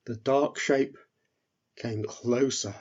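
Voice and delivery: usual speaking voice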